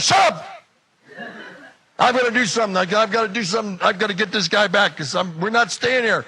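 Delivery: loud, high-pitched angry shout; furious shouting. Acted. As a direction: cut the friend off, ranting. Yells at friend, demands revenge.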